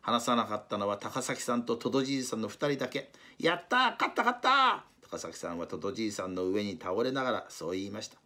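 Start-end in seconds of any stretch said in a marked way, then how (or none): 3.4-4.8 high, loud victory cry; cheering. Acted. Takasaki falling on the old walrus, a high loud victory cheer. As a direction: crow with triumph. Winning the tug of war.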